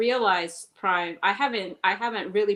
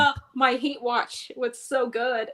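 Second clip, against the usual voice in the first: slow, higher, savouring gloat; mock gloating; 6 semitones higher, slower than usual. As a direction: relish it like a tasty meal. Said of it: "I was like".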